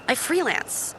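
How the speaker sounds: ordinary talk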